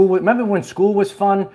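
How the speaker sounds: ordinary talk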